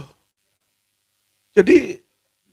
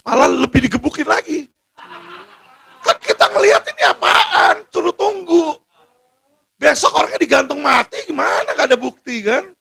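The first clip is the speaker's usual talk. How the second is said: real outrage; 6 semitones higher than usual. Real, not acted. Outrage over detainees beaten, threatened.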